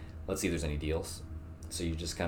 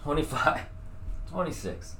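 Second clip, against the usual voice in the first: strained counting; 8 semitones higher than usual. Real, not acted. Straining push-ups.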